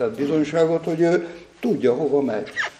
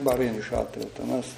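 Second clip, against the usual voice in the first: ordinary talk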